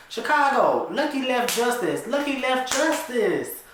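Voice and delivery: high, loud insistence; urgent report. Acted. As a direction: press the news on him. Urgently telling friend news.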